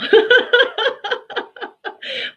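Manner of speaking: loud high-pitched burst of laughter; real laugh, high and loud; high mocking laugh. Real, not acted. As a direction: cackle at how ridiculous it was. Mocking the opponent's unprofessionalism.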